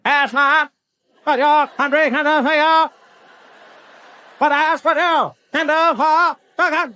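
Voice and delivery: high, drawn-out presidential oratory; exaggerated high-pitched speechifying, each phrase set apart; JFK. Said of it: "He had that thing where it's like".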